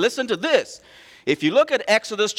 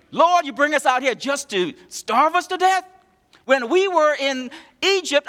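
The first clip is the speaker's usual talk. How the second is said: high whiny nagging complaint; nagging wife; 7 semitones higher than usual. Retold or imitated. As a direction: nag at the Lord like a wife. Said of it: "sound like a nagging wife".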